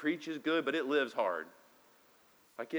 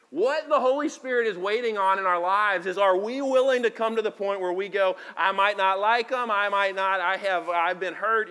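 raised, loud preaching voice; 4 semitones higher, 8 dB louder than usual. Acted. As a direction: preach it out with full force. Preacher building to prayer.

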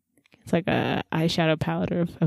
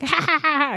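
mocking god laugh; 9 semitones higher than usual. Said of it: "he's up there laughing".

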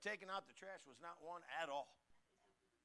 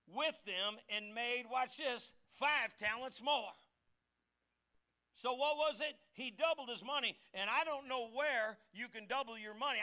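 preaching; 6 semitones higher, 7 dB louder than usual. Acted. Pastor telling how the servant doubled his money, emphatic. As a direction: press each word on the listeners.

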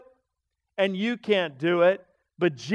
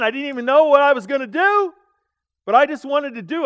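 higher and louder, urgent; rising intensity; 6 semitones higher, 7 dB louder than usual. Retold or imitated. Preacher reliving his temptation in Vegas, loud and urgent. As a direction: build the heat of the temptation.

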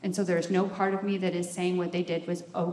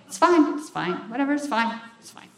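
sulky "fine, whatever", first one loud and high; passive-aggressive; 4 semitones higher, 7 dB louder than usual. Shown as a demonstration. Showing off her best fits, mock passive-aggressive sulk. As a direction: pretend it is fine when it isn't. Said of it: "I can be passive-aggressive with the best of 'em".

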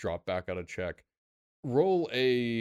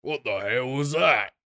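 slow, startled call from below; a guard calling up, alarmed and drawn out; voice from downstairs; 4 semitones higher, 8 dB louder, slower than usual. Acted. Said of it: "you can hear somebody say, like".

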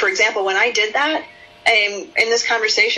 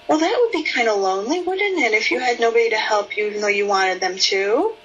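tender validating tone; 5 semitones higher than usual. Shown as a demonstration. Therapist validating a sad girl, tender and curious. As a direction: soothe her while wondering aloud. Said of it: "curious tone of voice".

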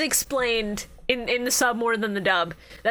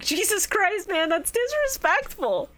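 higher-pitched mock offence, drawn out at the end; mock indignation; 7 semitones higher, slower than usual. Podcaster reacting to someone insulting Egypt, mock indignant. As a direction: play at being scandalised.

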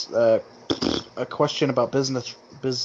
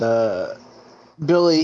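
ordinary talk, nothing marked

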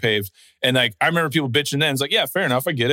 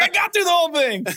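mock stoked. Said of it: "He's like".

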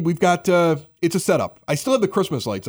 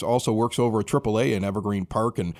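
ordinary talk

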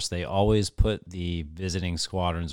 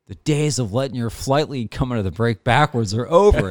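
loud, raised stern officer lecturing the pilots; stern commander speech; 4 semitones higher, 7 dB louder than usual. Retold or imitated. Officer lecturing pilots about flight leads, stern loud voice. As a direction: imitate the officer laying down the law. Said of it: "He's like".

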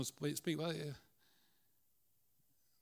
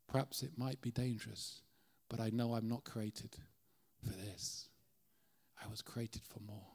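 zebra quietly resolved; 4 semitones lower, 8 dB quieter than usual. Acted.

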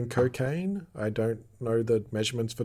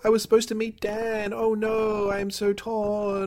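high, hammed-up distressed voice, easing in volume; melodramatic character plea; 10 semitones higher than usual. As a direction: whine like a tipsy drama queen calling for rescue. Acts out Serena's phone call.

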